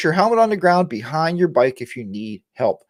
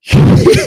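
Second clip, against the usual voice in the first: loud, breathy laugh; sudden amused cackle; burst of laughter; 11 dB louder than usual. Real, not acted. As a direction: laugh out loud at a friend's joke. Amused by viewer's comment.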